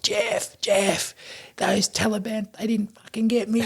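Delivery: high, strained mock yell at moderate volume; imitating delirious battlefield yelling without full volume; retold yelling. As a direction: call out to a mate in a high-pitched pretend holler. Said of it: "And I was yelling at him".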